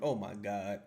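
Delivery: usual speaking voice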